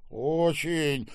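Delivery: loud growled reply; growling. A narrator's reading. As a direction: growl out your approval. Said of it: "прорычал Серёжа". (с рычанием, громко)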